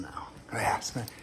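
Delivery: hushed aside, barely voiced; whisper. Real, not acted. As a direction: slip it out in a whisper as a sidenote. Aside muttered under his breath.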